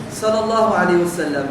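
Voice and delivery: normal talking voice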